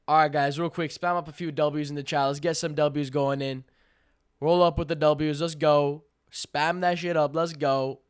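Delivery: loud, punchy rallying call; loud hyping. Real, not acted. Streamer rallying chat to spam W's, loud hyping. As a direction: hype up the crowd at full volume.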